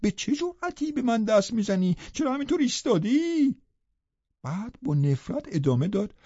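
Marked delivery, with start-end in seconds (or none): 0.0-3.5 shouted order. A narrator's reading. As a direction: order him out in a raised voice. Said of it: "داد کشید".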